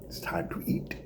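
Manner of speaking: a soft, breathy secret; hushed whisper. Acted. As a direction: let the punchline slip out in a whisper. Punchline hushed.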